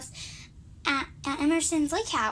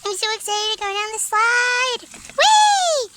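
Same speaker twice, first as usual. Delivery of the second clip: very high, loud whee down the slide; gleeful slide scream; 6 semitones higher, 6 dB louder, slower than usual. Acted. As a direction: squeal like riding a slide. Doll riding the water slide.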